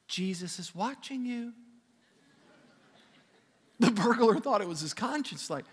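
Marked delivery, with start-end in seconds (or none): 0.1-1.5 soft, eerie sing-out from a dark corner; a hushed, mysterious warning. Retold or imitated. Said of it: "he hears his voice from the corner".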